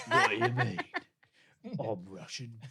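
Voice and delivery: Cockney voice claiming to be Russian; Cockney-accented insistence, Ray Winstone style. Retold or imitated. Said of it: "pure fucking Ray Winston".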